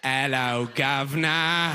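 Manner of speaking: drawn-out cockney greeting; mock British accent. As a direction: gleefully parrot a British accent. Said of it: "making sounds you like".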